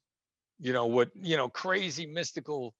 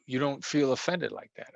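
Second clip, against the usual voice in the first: normal talking voice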